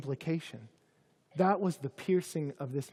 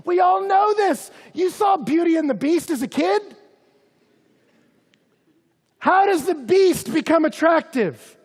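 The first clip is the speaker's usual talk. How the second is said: much higher and louder, near shouting; fiery preaching; 9 semitones higher, 10 dB louder than usual. Acted. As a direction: preach it with full passion. Preaching at full force.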